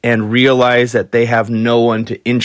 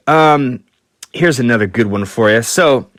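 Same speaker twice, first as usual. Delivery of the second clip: normal talking voice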